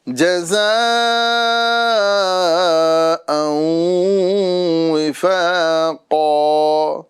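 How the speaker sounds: slow, melodic tajweed chant; Quranic recitation. Acted. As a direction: recite it measured and drawn out. Recites verse.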